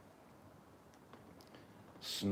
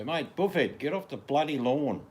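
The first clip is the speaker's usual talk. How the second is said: loud, raised-pitch Aussie yell; mock telling-off; 8 semitones higher, 10 dB louder than usual. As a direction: shout at a trespasser on your front lawn. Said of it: "I'd just say".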